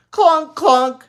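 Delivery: very high, loud gleeful clonks; happy shout. Acted. Little girl gleefully shouting as they go out. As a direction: shout it happily. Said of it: "shouted Ramona happily".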